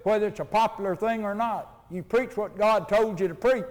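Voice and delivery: ordinary talk, nothing marked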